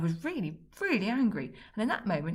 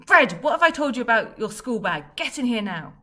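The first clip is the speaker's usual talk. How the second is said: much louder, furious summons; angry dad bellow; 5 semitones higher, 13 dB louder than usual. Acted. Dad bellowing at Fred about the school bag. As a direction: roar at the kid to come here.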